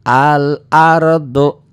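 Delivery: al-ardu with the l clearly sounded, slow and loud; careful recitation. Shown as a demonstration. Teacher showing pupils a lam that is read. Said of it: "Lamnya dibaca".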